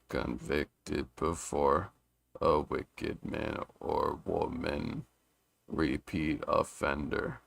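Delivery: very slow, soft, measured reading; slow syllable counting. Shown as a demonstration. Reader testing the haiku's five seven five, slow and measured. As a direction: spell out each syllable slowly, quietly.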